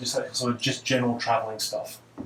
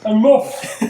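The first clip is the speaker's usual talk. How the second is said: laughing; 9 semitones higher, 9 dB louder than usual. Real, not acted.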